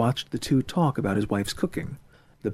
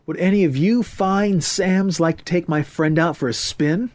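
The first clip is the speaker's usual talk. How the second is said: higher, showy invitation; gallant hepcat; 6 semitones higher, 3 dB louder than usual. Acted. Friend bows and offers Murray to dancers, gallant. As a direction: pitch it to the ladies with swagger. Ethan addresses the girls.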